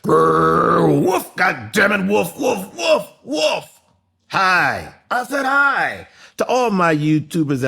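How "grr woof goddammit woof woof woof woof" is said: mock dog growl and barks, going higher; dog barking. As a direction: bark like a playful dog.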